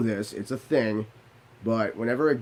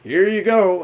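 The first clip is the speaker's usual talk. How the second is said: high, loud, puffed-up "Here you go"; mighty and proud; 8 semitones higher, 8 dB louder, slower than usual. Retold or imitated. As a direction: hand it over like a hero. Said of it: "I'm feeling so mighty".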